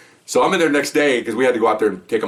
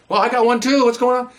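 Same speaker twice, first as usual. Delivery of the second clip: high, fake-innocent coworker voice; mock innocence; 13 semitones higher than usual. Coworkers pretending they got a flyer too, higher, in mock innocence. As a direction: play dumb in a high, sing-song voice. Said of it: "We go".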